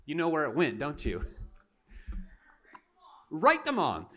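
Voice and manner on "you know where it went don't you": ordinary talk, nothing marked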